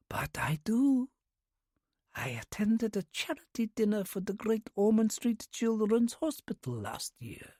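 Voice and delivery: put-on whispered, slow Russian-accented old lady; soft Russian accent. A narrator's reading. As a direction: breathe it like a breeze, Russian accent. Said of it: "the Russian accent undeniable".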